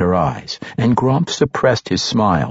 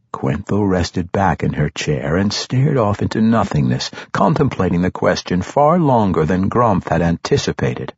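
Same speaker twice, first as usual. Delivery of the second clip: usual speaking voice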